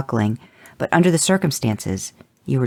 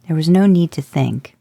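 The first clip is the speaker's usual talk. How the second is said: ordinary delivery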